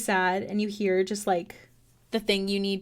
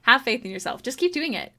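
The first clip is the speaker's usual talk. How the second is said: ordinary talk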